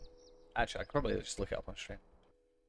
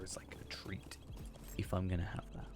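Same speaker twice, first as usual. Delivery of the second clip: whispering; 3 semitones lower, 11 dB quieter than usual. Real, not acted. Aside about Doctor Pepper treat.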